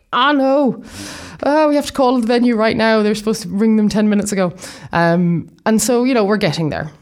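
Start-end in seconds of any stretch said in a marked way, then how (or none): 0.1-4.5 alarmed. Retold or imitated. Jumped up remembering the call.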